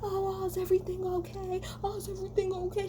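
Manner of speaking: high, fluttery mock concern; syrupy fake concern, way up high. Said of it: "Y'all are all fake as fuck".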